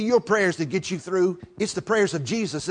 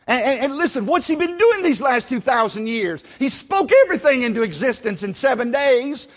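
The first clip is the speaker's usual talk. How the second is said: raised, high-pitched preacher's voice; fervent preaching; 7 semitones higher, 4 dB louder than usual. Real, not acted. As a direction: drive it home to the congregation, loud and high. Preaches at full force.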